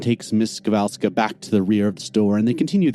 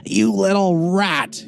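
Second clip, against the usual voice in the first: high, slow angry snarl; furious shout; 12 semitones higher, slower than usual. Acted. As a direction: spit it at the thief. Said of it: "he shouts".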